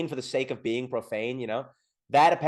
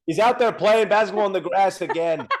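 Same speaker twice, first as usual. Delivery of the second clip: laughing mock neighbor; 6 semitones higher, 5 dB louder than usual. Imagining neighbors mocking him.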